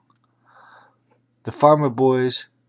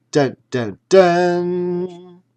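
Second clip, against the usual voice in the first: dramatic sting; 7 semitones higher, 6 dB louder, much slower than usual. Acted. Dramatic sting sound effect.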